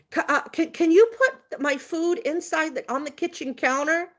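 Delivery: high, stammering imitation of a request; hesitant passenger. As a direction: imitate him timidly asking a favor. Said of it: "He only talked like this".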